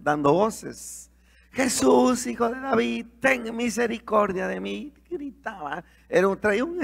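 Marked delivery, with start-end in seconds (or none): 1.5-4.9 a higher, mildly raised call for mercy; retold cry for mercy. Blind Bartimaeus calling to Jesus, mild mock cry. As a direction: call out to Jesus, not a full yell. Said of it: "él empezó a gritar".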